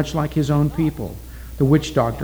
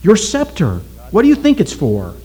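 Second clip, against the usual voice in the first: higher pressing questions; urgent challenge; 8 semitones higher, 3 dB louder than usual. A narrator's reading. Oki challenging the witch doctor, higher and urgent. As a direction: push the scepter question at him.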